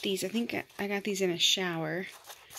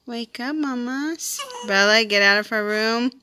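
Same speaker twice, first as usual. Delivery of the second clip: higher, sing-song cooing at a newborn; baby talk; 5 semitones higher, 5 dB louder than usual. Acted. Mom cooing at her newborn daughter, sing-song baby talk. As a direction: coo sweetly over the baby.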